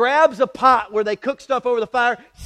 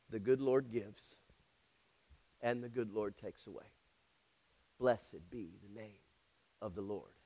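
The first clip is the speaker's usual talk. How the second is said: much softer and lower, reverent; hushed reverent quote; 12 semitones lower, 20 dB quieter than usual. Real, not acted. Preacher quoting Job's blessing, hushed and low. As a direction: let it fall to a near hush.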